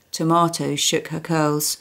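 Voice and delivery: ordinary delivery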